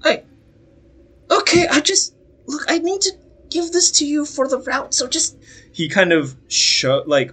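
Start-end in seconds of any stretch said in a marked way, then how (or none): 0.0-5.4 put-on much higher, stammering young boy; timid messenger boy, halting; nervous boy voice. Boy voice again.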